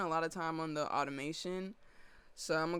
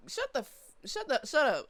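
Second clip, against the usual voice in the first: loud, high shut up; shouting; 10 semitones higher, 8 dB louder than usual. Real, not acted. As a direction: yell it at the haters. Telling critics off.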